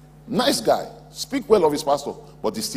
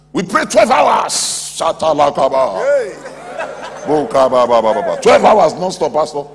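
loud mock boasting; 7 dB louder than usual. Young believer boasting to pastor about praying, loud mock bragging. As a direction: blast it out like a show-off praying in tongues. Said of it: "They are boasting".

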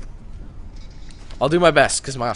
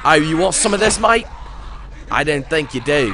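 raised, taunting Aussie challenge; Australian accent; 4 semitones higher, 4 dB louder than usual. Acted. Player daring a zombie to fight, loud Australian accent. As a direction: square up in a put-on Australian accent. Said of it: "How's my Australian accent?".

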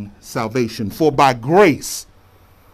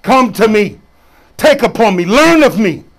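preaching; 8 semitones higher, 8 dB louder than usual. Real, not acted.

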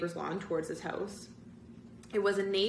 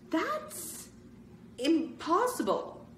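much higher, slow disbelief; shocked cry; 11 semitones higher, much slower than usual. Acted. Sherry seeing the impossible photo, shocked cry. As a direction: gasp it out in disbelief. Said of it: "Sherry cried".

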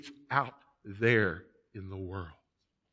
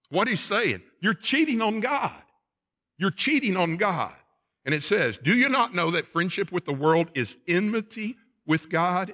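raised, higher preaching voice; forceful preaching; 8 semitones higher, 5 dB louder than usual. Acted. Preacher warning the congregation against cheating on God, forcefully. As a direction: drive the warning home to the congregation.

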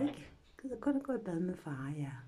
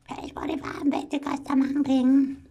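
mom's yell; 8 semitones higher, 9 dB louder than usual. Retold or imitated. Imitating her mother yelling about the hot water. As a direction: nag like a scolding mother. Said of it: "råbte min mor og skreg altid".

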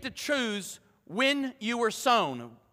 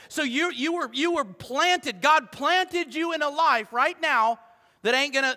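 usual speaking voice

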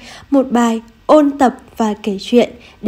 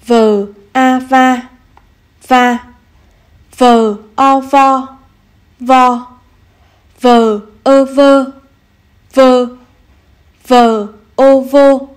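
slow spelling then reading; 3 dB louder, slower than usual. Shown as a demonstration. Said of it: "cùng cô đánh vần và đọc".

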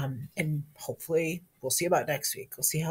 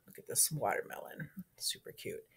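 whispering; 3 semitones lower, 12 dB quieter than usual. Real, not acted. Showing keychain, hushed.

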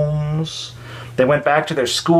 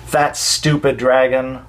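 plain reading voice

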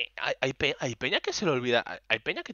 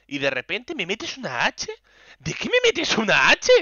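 higher and louder, slow exasperated protest; mock indignant complaint; 7 semitones higher, 6 dB louder than usual. She imagines scolding the staffer, building indignant and higher. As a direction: drag out the protest, building to a cry.

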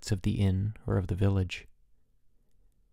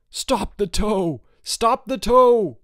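high, slow bellow, building louder; father's roar; 11 semitones higher, 6 dB louder, much slower than usual. A narrator's reading. Father calling to save his daughter on the tow, roaring louder. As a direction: stretch each word into a rising, louder yell. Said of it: "her father roared".

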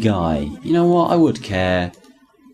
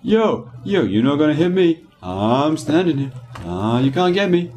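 higher, cocky enemy teasing the player; mock cocky enemy taunt, pitched up; 6 semitones higher than usual. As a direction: voice a smug grunt daring you to shoot. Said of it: "You're kind of standing there like".

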